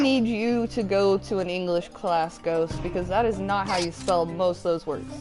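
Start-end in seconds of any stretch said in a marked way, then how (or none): none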